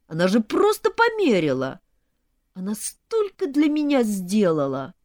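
high, loud-edged protest; upset pleading. A narrator's reading. Groom defending his mother to bride, upset. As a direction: plead mother's case, aggrieved.

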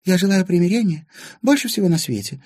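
high-pitched, eager show of goodwill; sudden change of tone. A narrator's reading. Anfisa wishing for reconciliation, abruptly changed tone. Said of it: "тут же сменила тон Анфиса".

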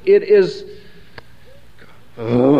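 ordinary delivery

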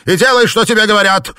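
high, loud barked order; angry command. A narrator's reading. Husband silencing his wife, angry shout. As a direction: slam the order down on her.

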